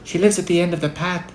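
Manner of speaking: put-on high, timid child's reply; small high-pitched girl, slowing; faint little-girl voice. A narrator's reading. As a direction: answer the wolf in a small, high voice. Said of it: "in a faint voice".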